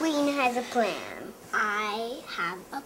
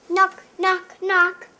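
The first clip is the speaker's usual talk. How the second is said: loud, high knock knock; knocking sound; 8 semitones higher, 10 dB louder than usual. Acted. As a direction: call out the knocks.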